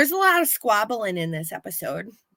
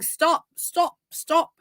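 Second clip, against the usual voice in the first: higher, mild mock yell; imitating a frantic warning, pitched up; 7 semitones higher, slower than usual. Russian guy warning them, a mild mock yell. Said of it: "the Russian guy starts yelling".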